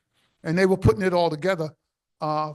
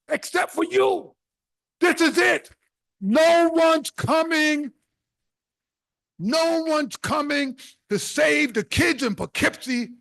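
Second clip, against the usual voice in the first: preaching; 5 semitones higher than usual. Acted. Urging the audience to save the kids, preaching. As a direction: drive it home like a sermon. Rallying the audience about poverty.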